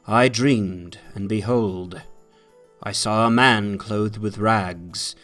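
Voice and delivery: ordinary delivery, nothing marked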